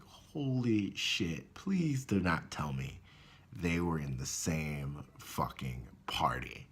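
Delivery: slow, breathless whisper of dread; horrified whisper. Retold or imitated. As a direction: whisper it like you can't believe it. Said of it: "And I'm like".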